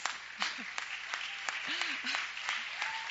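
breathy laugh. Real, not acted. Laughing while thanking.

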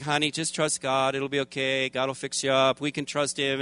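calm a scared husband softly; soft reassuring wife. Retold or imitated. Wife comforting him at night.

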